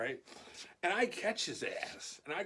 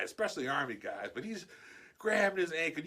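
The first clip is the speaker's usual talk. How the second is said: usual speaking voice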